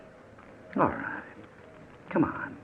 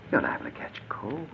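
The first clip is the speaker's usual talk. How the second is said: voiceless, softer mock warning; mocking whisper; 5 semitones higher, 6 dB quieter than usual. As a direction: breathe the taunt in a whisper. Kidnapper mocks victim's shivering.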